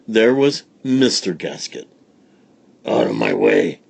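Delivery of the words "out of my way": low, loud menacing growl. A narrator's reading. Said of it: "growled the robber".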